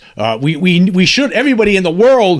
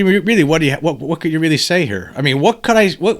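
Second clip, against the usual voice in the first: normal talking voice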